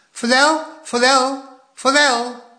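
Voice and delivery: put-on high, loud little-boy cheer; delighted toddler calling out the fish; enthusiastic toddler. A narrator's reading. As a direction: chant it like a thrilled tiny kid. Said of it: "riep de kleine Poekie enthousiast".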